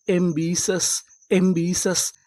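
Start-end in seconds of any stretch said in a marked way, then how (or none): none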